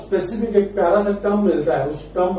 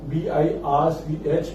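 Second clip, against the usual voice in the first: slow spelling out; 2 semitones lower, 3 dB quieter, much slower than usual. Shown as a demonstration. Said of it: "heading डाल दो मेरे साथ".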